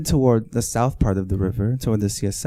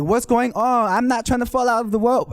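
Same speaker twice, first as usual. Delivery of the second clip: mock scared cry; 10 semitones higher than usual. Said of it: "scared and screaming".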